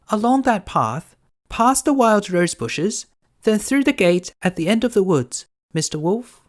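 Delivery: put-on little girl voice. Girl answering the wolf, high and innocent.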